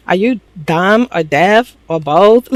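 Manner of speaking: slow, word-by-word retold challenge; pointed mock disbelief. Trinity challenging Corbin's question, slow mock exasperation. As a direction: space out each option slowly and pointedly.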